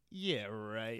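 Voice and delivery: low, dry disbelief; sceptical kid. Retold or imitated. Remembering being a kid who doubts, low and dry. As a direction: scoff at an obvious lie. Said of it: "being like".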